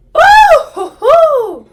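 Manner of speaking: very high, very loud whoops; excited whooping. Real, not acted. Viewer cheering Monica on screen, whooping with excitement. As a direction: whoop with laughing delight. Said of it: "Sorry, I'm excited, guys".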